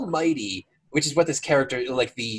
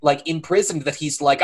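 normal talking voice

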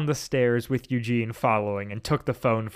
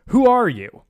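higher and louder, firmer challenge; stronger; 10 semitones higher, 9 dB louder than usual. Acted. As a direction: stand up to the caller. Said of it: "Then stronger".

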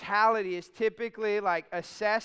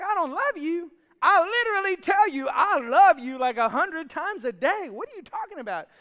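indignant disbelief; 7 semitones higher, 7 dB louder than usual. Retold or imitated. Husband protesting wife's complaint.

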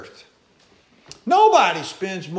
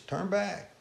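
quiet warning; 9 dB quieter than usual. Acted. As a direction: say it almost under the breath. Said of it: "telling every time they walk up there".

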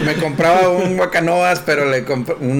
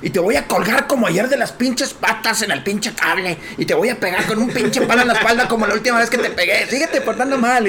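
higher-pitched sham tirade; mock yelled threat; 8 semitones higher than usual. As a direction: fake father berating his child in public. (amenaza gritada de broma)

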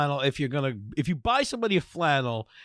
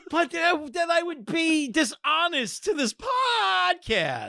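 higher, slow mock protest; mock indignant; 11 semitones higher, slower than usual. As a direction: play it like a wounded principle. Mock protest at friend's joke.

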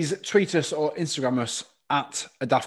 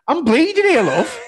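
laughing protest; 12 semitones higher, 7 dB louder than usual. Retold or imitated. Kid answering dinner lady.

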